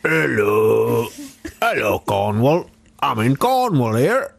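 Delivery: slow, hammed-up Cornish accent; a bad mock Cornish yokel, rising high at the end; jokey Cornish voice on request.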